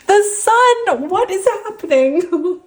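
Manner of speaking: overjoyed, can't believe it; thrilled squeal. Real, not acted. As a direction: shriek with joy at the card. Happiest card comes up.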